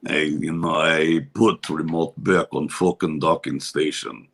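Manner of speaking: low, slow Russian accent. Shown as a demonstration. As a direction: drop deep into a thick Russian voice. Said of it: "I can do my Russian voice".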